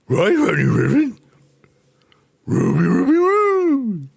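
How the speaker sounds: squealing high falsetto calls of Ruby, loud; silly laugh. Shown as a demonstration. Streamer shows a viewer a silly high squeal laugh. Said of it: "Can you laugh like this?".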